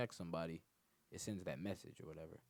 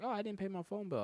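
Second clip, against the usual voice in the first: high mock girl voice, louder; voicing her as a silly excuse; mimicking the girl; 12 semitones higher, 5 dB louder than usual. Retelling the girl's excuse in a mock high voice.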